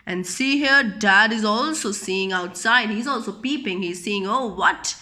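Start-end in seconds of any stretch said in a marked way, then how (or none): none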